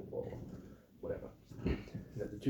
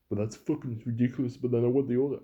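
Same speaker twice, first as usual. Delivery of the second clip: loud, higher mock rant; hammed-up customer outburst, much louder; angry customer; 4 semitones higher, 12 dB louder than usual. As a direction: blow up like an entitled customer. Customer blowing up.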